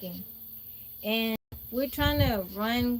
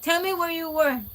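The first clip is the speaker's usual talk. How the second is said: higher and louder mock yell; angry aunt demand; 6 semitones higher, 6 dB louder than usual. Furious aunt demanding the truth from her niece, raised voice. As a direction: snap out the order. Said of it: "My aunt is mad".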